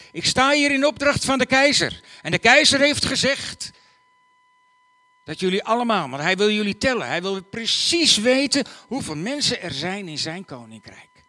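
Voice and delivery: high, loud town-crier announcement; herald proclaiming. Acted. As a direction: proclaim it to the whole square. Herald proclaims the emperor's order.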